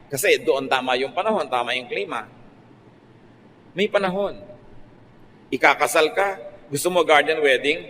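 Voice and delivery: normal talking voice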